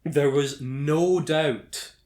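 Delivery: slow, stressed "no doubt"; "There was no doubt" slow and pointed; emphatic repeat. Acted. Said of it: "We've established that there was".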